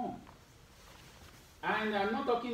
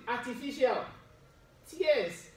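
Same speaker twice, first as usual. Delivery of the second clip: "Artificial. Tears." said word by word, higher; spelled-out emphasis; 5 semitones higher, slower than usual. Shown as a demonstration. Preacher quizzing listeners on the word, pointed stress. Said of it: "what did I just call it?".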